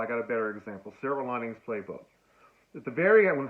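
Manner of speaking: ordinary talk, nothing marked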